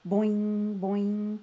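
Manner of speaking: loud springy boing boing; boing sounds. Acted. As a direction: bounce it out like a hopping frog. Frog hopping sound effect.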